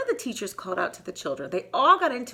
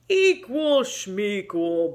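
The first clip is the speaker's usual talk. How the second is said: slow, drawn-out scoffing bear voice; dismissive bear; 4 dB louder, much slower than usual. Acted. Bear scoffing at Mouse about fair teams, slow and drawn out. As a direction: brush it off like a careless bear. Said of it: "Bear mumbled".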